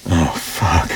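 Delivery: ordinary delivery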